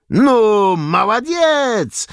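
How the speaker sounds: high, loud, drawn-out whoop; gleeful cheer. A narrator's reading. Soldiers cheering the groping Cossack, gleeful drawn-out cheer. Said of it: "ucieszyli się żołnierze".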